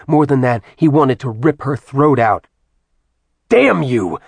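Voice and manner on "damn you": louder angry outburst, falling in pitch; snarled. A narrator's reading. Acheron lashes out at Artemis, snarling. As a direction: snarl it out in rage. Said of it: "he snarled".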